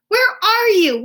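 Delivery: very high, loud call; crying out. A narrator's reading. Owl jumping on his bed yelling at the bumps, very loud. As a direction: yell it while jumping. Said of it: "he cried".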